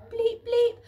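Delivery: two loud high bleeps; loud high mock keypad beeps; double beep. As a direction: beep twice like a cheerful keypad. Keypad beeps green light.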